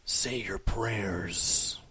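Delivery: slow low ominous read. Pretend. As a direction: intone it like a horror trailer.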